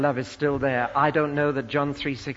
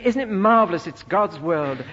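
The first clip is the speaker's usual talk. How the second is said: higher, louder exclamation of wonder; mock rapture; 5 semitones higher, 6 dB louder than usual. Preacher imitates someone admiring the hills, mock rapture. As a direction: gush like an enraptured nature lover.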